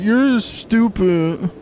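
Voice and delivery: put-on childish insult. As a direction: drag it out like a child taunting. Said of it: "fully grown adults being angry".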